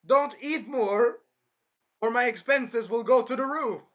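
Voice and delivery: high, full-volume exasperated shouting; shouted refusal. Acted. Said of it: "And it's like".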